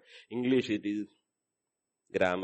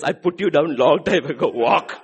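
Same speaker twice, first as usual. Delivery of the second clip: firm command; 7 dB louder than usual. Acted. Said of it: "God says".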